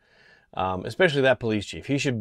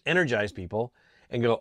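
usual speaking voice

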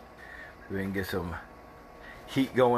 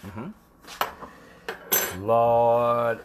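ordinary talk

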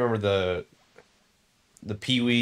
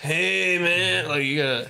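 hippie voice; 8 semitones higher than usual. Shown as a demonstration. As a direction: stretch it out like a stoner. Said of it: "Give him a hippie voice".

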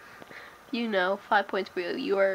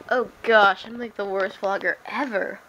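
ordinary talk, nothing marked

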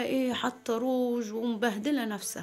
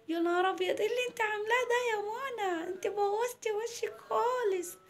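put-on shocked children; 10 semitones higher than usual. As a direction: exclaim like little kids at their friend's face. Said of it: "قالوا لها". (صوت عيال مصدومين)

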